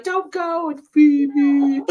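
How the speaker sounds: high, loud mock wail; hammed-up high-pitched plea; pleading child character. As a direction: call out desperately, imitating a little girl. Quotes film girl's plea to monster.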